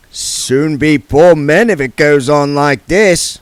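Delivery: slow, lightly voiced jovial gambler; mock complaint to a mark; gambler's voice. Companion voiced.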